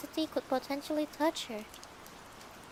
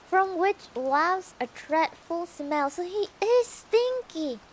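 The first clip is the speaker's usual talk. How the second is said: loud, higher, drawn-out disgust; exclaiming disgust; 5 semitones higher, 6 dB louder, slower than usual. Real, not acted. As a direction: draw it out, loud and grossed out.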